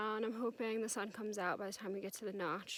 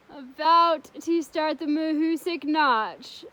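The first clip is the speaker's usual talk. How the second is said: laughing; 5 semitones higher, 14 dB louder, slower than usual. Real, not acted. Laughing before the Notch.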